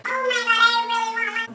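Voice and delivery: high, loud squealing want; retold excitement. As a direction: gush like you just saw it in a store window. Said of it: "and I was like".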